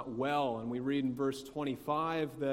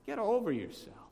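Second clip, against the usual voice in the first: dismissive; 8 semitones higher than usual. Retold or imitated. Imagined dismissive father, eye roll.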